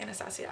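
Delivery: Anastasia said softly as "anna-STAH-see-uh"; corrected pronunciation. Shown as a demonstration. Vlogger repeating the brand's right name, Anastasia softly ending in see-uh. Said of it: "That's how you pronounce it".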